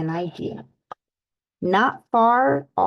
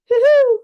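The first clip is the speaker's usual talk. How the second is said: high, loud bouncy whoop; Tigger hoot; 8 dB louder than usual. A narrator's reading. Tigger shows off his springs with a loud whoop.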